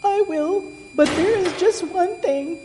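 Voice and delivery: very high piglet voice; squeaky little pig bargaining; sighing piglet. A narrator's reading. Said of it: "sighed the piglet".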